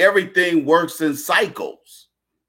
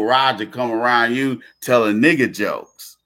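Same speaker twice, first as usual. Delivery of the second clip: usual speaking voice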